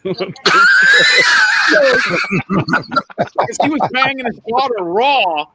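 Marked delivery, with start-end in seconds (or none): 0.2-3.6 loud high laugh. Real, not acted. Recalling the coach's glass eye, loud high laughter. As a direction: burst out laughing.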